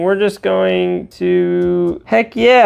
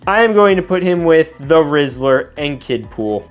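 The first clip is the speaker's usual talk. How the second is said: normal talking voice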